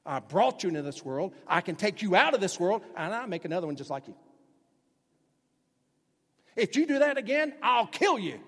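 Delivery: loud furious parent rising into a yell; angry parent. Retold or imitated. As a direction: scold like an enraged parent. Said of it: "said something like this".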